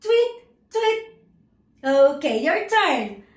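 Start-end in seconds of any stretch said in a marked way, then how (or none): 0.0-1.2 high, loud imitated chirps; teacher's high-pitched bird call; bird tweet. Shown as a demonstration. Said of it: "The bird says".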